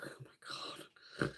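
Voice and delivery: slow, breathless whisper; hushed awe. Retold or imitated. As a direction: whisper it in stunned disgust.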